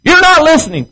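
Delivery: high, loud whiny nagging wife; hammed-up shrill-voiced wife calling out. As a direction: squeal like a wife demanding attention. Acted marital quarrel, wife nagging.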